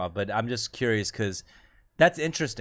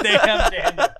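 high and loud, laughing through "Damn, Daniel"; laughing meme quote; 8 semitones higher, 7 dB louder, much slower than usual. Retold or imitated. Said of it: "He said".

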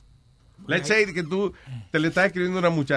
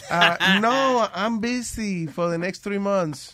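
mock laughing brush-off; 6 semitones higher, much slower than usual.

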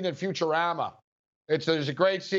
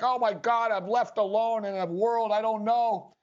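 higher, hammed-up whimper of despair; mock crying robot; 5 semitones higher than usual. Retelling how Bender cries when left in Springfield, mock sob. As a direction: play the stranded robot bawling, half-joking. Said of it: "Bender starts crying and he's like".

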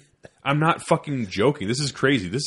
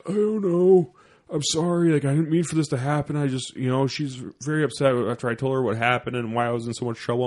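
higher-pitched sheepish soldier imitation; mock-apologetic, pitched up; meek apology; 3 semitones higher than usual. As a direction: imitate a soldier pleading his excuse.

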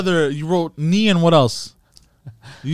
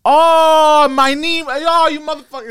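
higher, loud "Oh, my knee" catching on; sudden realization; 9 semitones higher, 4 dB louder than usual. Shown as a demonstration. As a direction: realize the word mid-line, startled. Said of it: "first I did".

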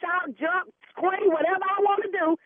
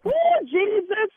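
slow, loud, higher repeated question; louder repeat; 5 semitones higher, 4 dB louder, slower than usual. Acted. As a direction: say it again, louder and slower. Repeats question after "What?.

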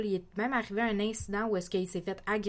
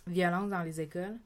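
normal talking voice

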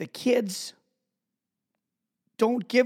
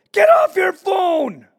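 full higher, louder, slower shouted "Get off your phone!"; shouting "Get off your phone!" at a mom ignoring her kid; yell out the window; 12 semitones higher, 11 dB louder, slower than usual. Retold or imitated. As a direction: bellow it across the street at the mom. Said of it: "So I yell out the window".

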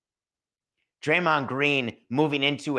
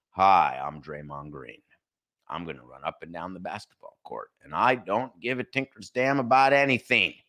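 flat mock Draymond; 6 semitones lower than usual. Imitating medicated Draymond.

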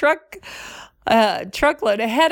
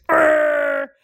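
macho grunt; 4 semitones higher, 3 dB louder than usual. Retold or imitated. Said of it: "they walk around like this".

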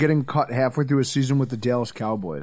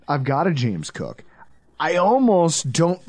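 normal talking voice

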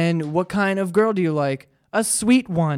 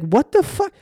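pitched way up in disbelief; what-the-hell reaction, much higher; incredulous; 9 semitones higher than usual. Retold or imitated. Disbelief at dumb show.

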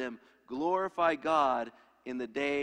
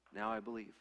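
softer echo; 3 semitones lower, 8 dB quieter than usual. Retold or imitated. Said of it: "they'll say".

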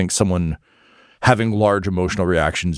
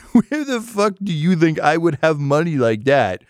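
can you believe this, pitched way up; exasperated accused man; 10 semitones higher than usual. Acted. Accused noble scoffing at the evidence, high and incredulous.

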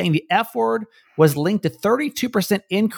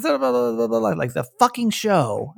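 mock frustrated mother; 8 semitones higher than usual. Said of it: "like, frustrated, says".

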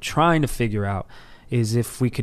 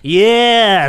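put-on drunk enthusiasm; 7 semitones higher, 6 dB louder than usual. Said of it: "I'm drunk and I'm like".

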